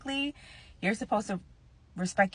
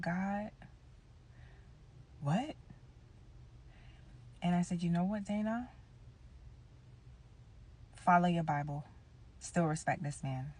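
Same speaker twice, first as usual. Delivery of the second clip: hushed inner prayer; 13 dB quieter than usual. Retold or imitated. Silent prayer in her head.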